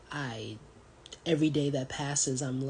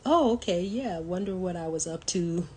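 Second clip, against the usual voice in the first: higher, louder, laughing through it; amused at herself, laughing; 5 semitones higher, 4 dB louder than usual. Real, not acted. Laughing at her own spending.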